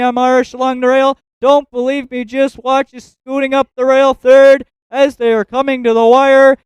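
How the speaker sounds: usual speaking voice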